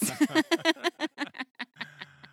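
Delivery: long, high burst of laughter; real helpless laughing fit; big laugh. Real, not acted. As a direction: crack up laughing, high and loud. Bursts out after her question.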